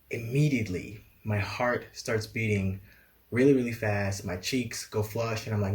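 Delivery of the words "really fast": plain reading voice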